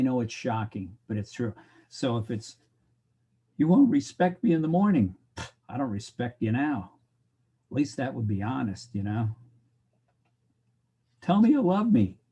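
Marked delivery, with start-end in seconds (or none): 3.6-5.2 higher, louder put-on woman's plea; mock wary woman. Woman doubting a man's pickup promise, mock plea. Woman's line quoted to a man. 11.2-12.2 higher, louder needy plea; mock pleading. Lover begging to hear I love you, mock plea.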